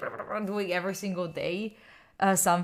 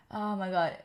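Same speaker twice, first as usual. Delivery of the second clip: normal talking voice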